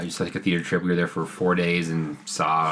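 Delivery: normal talking voice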